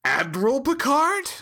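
a sharp high rise on the name, caught off guard; startled to hear the name; surprised recognition. Retold or imitated. Crime lord recognizing Picard in her bar, surprised high rise. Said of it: "she's like".

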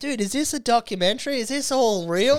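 high, loud retold astonishment; mock incredulity. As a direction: tease with over-the-top disbelief. Retold teasing during the film.

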